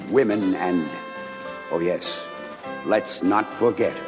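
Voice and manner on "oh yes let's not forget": normal talking voice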